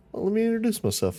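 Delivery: put-on high, quick sly child voice; creepy kid voice. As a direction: introduce yourself like a sly ghost kid. Ghost boy speaking.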